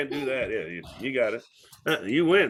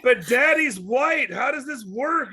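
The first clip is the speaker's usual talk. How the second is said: put-on confused kid; 5 semitones higher than usual. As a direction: play a baffled child, pitched up. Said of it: "They're like".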